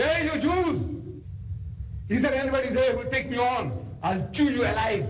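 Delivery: mild mock giant's taunt. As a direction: jeer like a bullying giant. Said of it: "is shouting".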